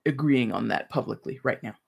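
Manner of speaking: usual speaking voice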